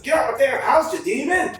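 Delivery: high, loud exclamation as a startled hero; mock outraged shout. Storm jumps up at a demon in the house, mock outraged shout.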